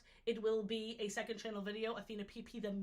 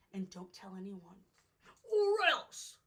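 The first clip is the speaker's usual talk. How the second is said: hammed-up warning; mock threat; 2 semitones lower, 5 dB louder than usual. Warning the viewers, mock menace rising to a loud "or else".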